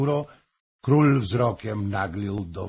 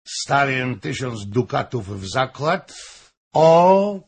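shouted wager; 3 semitones higher, 7 dB louder than usual. A narrator's reading. Fürstenberg bets against Hoym's wife, shouting. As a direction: shout out the bet, climbing at the end. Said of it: "krzyknął Fürstenberg".

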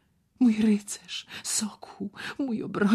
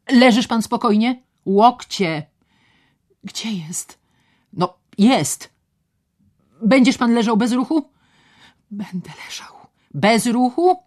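barked orders; 8 semitones higher, 10 dB louder than usual. Acted. Doctor and patient exchange orders.

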